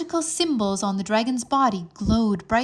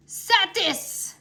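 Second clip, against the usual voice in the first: high, loud spell bellow; 11 semitones higher, 4 dB louder than usual. Acted. Wizard dragon attacking the earth dragons, bellowing. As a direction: blast the word at the dragons. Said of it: "Zoltan bellowed".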